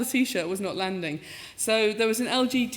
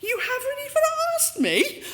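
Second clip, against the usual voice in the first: theatrical huffy complaint, slow and rising; mock indignation; slower than usual. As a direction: ham up being wronged. Mock outrage at being overlooked.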